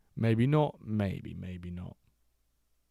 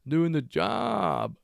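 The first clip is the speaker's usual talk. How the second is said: drawn-out praise; 8 semitones higher, much slower than usual. Acted.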